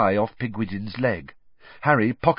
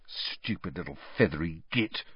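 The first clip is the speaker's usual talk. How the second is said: low, much softer hiss; 5 semitones lower, 9 dB quieter than usual. A narrator's reading. Ron curses his owl in a low hiss. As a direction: spit it under your breath. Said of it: "Ron hissed".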